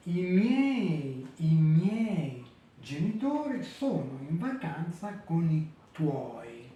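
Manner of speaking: careful slow Italian, miei and tuoi in one syllable; slow teacher Italian; Italian sentence read. Shown as a demonstration. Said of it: "vous avez les mots avec des triphtongues".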